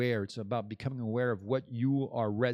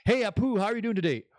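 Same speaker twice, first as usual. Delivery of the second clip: mock cheerful greeting; 9 semitones higher, 5 dB louder, faster than usual. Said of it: "this is me talking".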